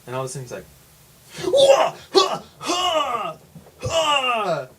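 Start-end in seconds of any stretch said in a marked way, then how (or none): 1.5-4.7 loud, high wordless bursts; imitated startling yells. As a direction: shout out sudden wild cries, imitating a strange man. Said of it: "I'm gonna be the man".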